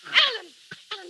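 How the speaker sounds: loud, high alarmed cry. Acted. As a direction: cry out as he jumps. He jumps from window.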